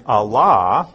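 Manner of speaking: Allah said slow and firm as ah-LAH; Greek alla. Shown as a demonstration. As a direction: set Allah apart as the strong Greek word. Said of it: "weak adversative, and Allah".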